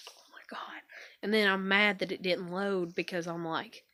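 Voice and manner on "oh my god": hushed, voiceless muttered aside; exasperated whisper. Retold or imitated. Interrupted while making a YouTube video, exasperated whisper. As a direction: breathe it out privately, fed up.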